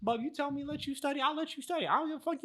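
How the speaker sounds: a high teasing shrug of a line; mock girlfriend voice. Girlfriend Cassie voiced in story.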